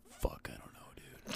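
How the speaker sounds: barely audible, slow whisper to oneself; muttered whisper. Real, not acted. Host unsure which couple to pick, whispering to self. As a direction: whisper it under your breath, undecided.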